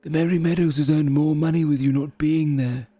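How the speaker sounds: sneering taunt, fading on the insult; mocking mirror head. Mirror head insulting Melvin with a sneer. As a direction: jab at him coolly. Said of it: "one opposite goes".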